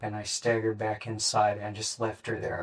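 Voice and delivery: ordinary delivery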